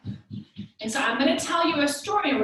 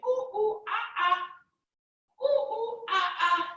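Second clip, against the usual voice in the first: monkey calls; 8 semitones higher than usual. Pretend. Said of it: "Can you say".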